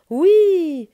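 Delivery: a loud, high cheer of yes; shouted yes. A narrator's reading. All the animals shouting agreement, loud cheer. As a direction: shout it out together. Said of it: "en criant".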